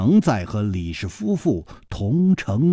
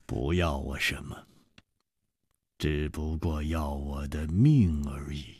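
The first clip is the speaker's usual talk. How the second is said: low, hushed, near-whispered calm; said coolly; 9 semitones lower, 8 dB quieter than usual. A narrator's reading. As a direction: drop it softly and lightly. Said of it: "淡淡的说". (淡淡地低声说)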